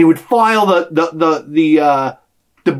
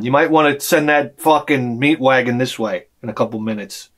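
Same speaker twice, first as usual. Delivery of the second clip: usual speaking voice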